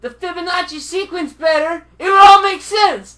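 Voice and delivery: high, loud know-it-all rant; loud mock lecturing. Real, not acted. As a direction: yell it like a smug expert. Shouting back in the argument.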